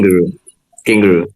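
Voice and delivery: low, repeated name call; insistent calling. Real, not acted. Interrupting the storyteller by name, insistent low calling.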